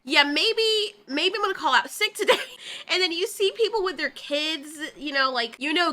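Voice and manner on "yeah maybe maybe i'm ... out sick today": laughing mock resignation.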